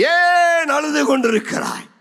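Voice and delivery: high-pitched preacher's cry; impassioned cry. Acted. Preacher challenging the weeping congregation, crying out.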